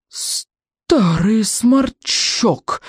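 slow, choked, indignant insult; gasping outrage. A narrator's reading. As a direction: splutter it out in fury. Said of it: "задохнулся Стоик".

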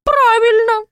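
high, loud gleeful crone; loud old witch. Acted. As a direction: cackle agreement like a witch. Baba Yaga agrees loudly. (громкая довольная старуха)

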